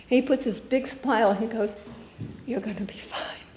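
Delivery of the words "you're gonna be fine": soft whispered reassurance. Retold or imitated. Swami reassuring a sick woman in a whisper. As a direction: whisper it gently.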